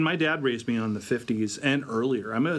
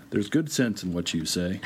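lightly voiced crooner, lower; a hint of a calm crooner; [name]; 6 semitones lower than usual. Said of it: "[name]'s character, sits down and goes".

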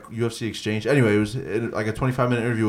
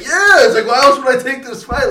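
high, loud retort acted out; indignant shout; 14 semitones higher, 7 dB louder than usual. Co-host acts out Max answering his challenger, loud and indignant. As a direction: burst out with a high-pitched, incredulous comeback. Retold fighter's indignant comeback.

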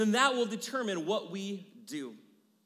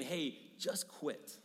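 slow, soft, defeated inner voice; the quiet voice that says give up; discouraging inner voice; 6 semitones lower, 9 dB quieter, slower than usual. Pretend. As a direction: let it sink down like doubt. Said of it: "the voice I hear is just saying".

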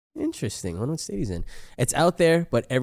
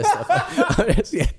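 laughing; 4 dB louder, much slower than usual. Real, not acted. Breaks into laughter.

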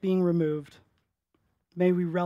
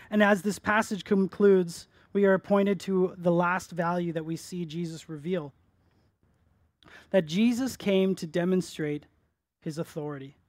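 ordinary talk, nothing marked

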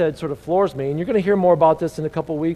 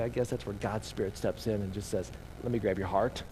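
soft and low retold words; hushed, earnest; 8 semitones lower, 11 dB quieter than usual. Preacher who can't convince you gives way to God's Spirit, softly. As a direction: drop low and quiet, as the Spirit stepping in.

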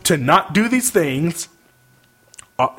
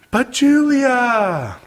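whiny voice; 7 semitones higher, much slower than usual. Retold or imitated. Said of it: "in your whiny little bitch voice".

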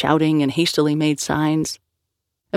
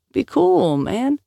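soothing; 9 semitones higher, much slower than usual. Acted. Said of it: "said Gian".

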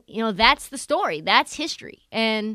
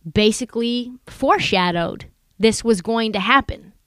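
normal talking voice

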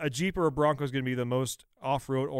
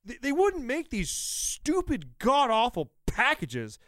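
loud, high, furious ranting; angry rant; 8 semitones higher, 4 dB louder than usual. Real, not acted. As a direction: shout it in real outrage. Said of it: "How much that pisses me off".

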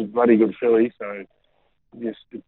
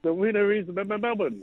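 mock winner announcement; 10 semitones higher than usual. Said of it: "deliver it like that for us".

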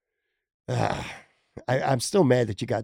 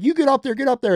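mock pushy friend; 10 semitones higher, faster than usual. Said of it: "people sometimes are like".

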